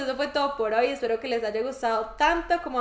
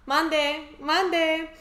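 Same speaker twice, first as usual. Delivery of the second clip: imitating Mexican accent; 5 semitones higher than usual. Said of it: "es como el mande".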